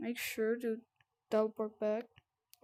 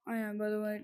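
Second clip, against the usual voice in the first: ordinary talk, nothing marked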